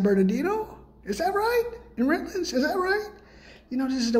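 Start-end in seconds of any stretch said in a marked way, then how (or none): 1.1-1.6 much higher, mock-incredulous rise; sarcastic disbelief; sarcastic question. Mocking rhetorical question. 2.0-3.1 much higher mock-incredulous rise again on right; sarcastic disbelief; sarcastic question. Mocking rhetorical question.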